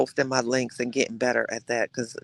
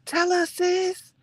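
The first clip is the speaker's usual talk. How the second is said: eager prompt; much slower than usual. Real, not acted. Urging a viewer for gossip.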